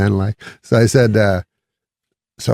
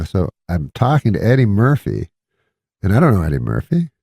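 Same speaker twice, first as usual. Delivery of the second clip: usual speaking voice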